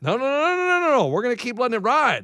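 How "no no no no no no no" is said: high-pitched rapid string of noes; urgent refusal. Real, not acted. Card owner stopping co-host from selling, urgent protest. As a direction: jump in high to stop it. Refusing to let him sell.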